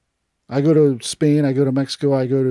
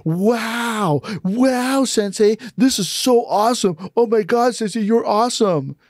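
much higher, starstruck gushing; gushing fanboy; 8 semitones higher, slower than usual. Retold or imitated. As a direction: gush over your hero like a fan. Gushing at Sensei, then scolded.

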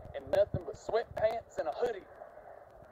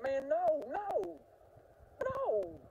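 higher, begging no no no; the friend whining for help; pleading friend; 9 semitones higher, much slower than usual. Retold or imitated. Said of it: "He was like".

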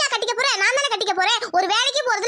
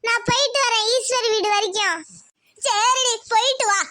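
normal talking voice

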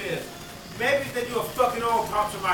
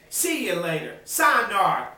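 ordinary talk, nothing marked

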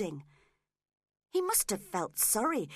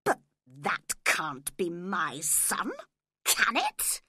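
aggressive; 2 semitones lower, 4 dB louder, slower than usual. A narrator's reading. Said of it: "he continued aggressively".

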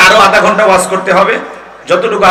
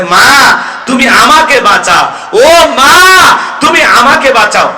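high, drawn-out plea to mother; son's cry for help; 5 semitones higher, 3 dB louder, slower than usual. Acted. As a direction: call out loudly, stretching the word for mother. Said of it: "কিছুক্ষণ পরে ছেলেটাই বলতেছে".

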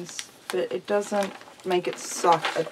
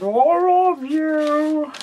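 slow, higher sing-song bird voice; imitating her bird; 6 semitones higher, 5 dB louder, much slower than usual. Owner telling viewers how her bird says I love you, slow sing-song imitation. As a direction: copy how the bird croons it. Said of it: "Normally she sounds like she's singing".

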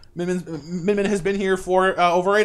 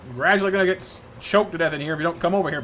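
normal talking voice

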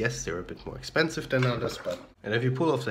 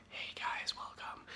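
awkward whispered vlog intro; barely audible, re-enacted whisper; imitating hushed greeting; 13 dB quieter, slower than usual. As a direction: whisper so the sleeping flatmates don't wake. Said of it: "my flatmates were sleeping".

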